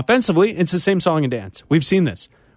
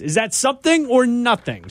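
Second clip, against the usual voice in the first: game show host; 8 semitones higher than usual. Acted. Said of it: "game show catchphrase".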